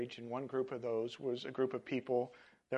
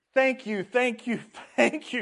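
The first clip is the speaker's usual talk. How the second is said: much higher and louder, laughing thanks; joyful shouting; 11 semitones higher, 11 dB louder than usual. A narrator's reading. Healed leper shouts thanks to Jesus, laughing joy. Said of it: "shouted the man".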